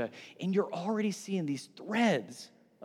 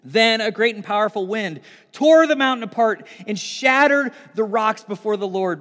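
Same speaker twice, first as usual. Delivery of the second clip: ordinary talk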